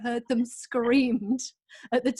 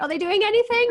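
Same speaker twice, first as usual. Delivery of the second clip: higher, louder nosy neighbour calling over; mock nosy neighbour; 10 semitones higher, 8 dB louder than usual. Imitating a neighbour checking on the kids, loud and high. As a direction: call over the fence like a busybody.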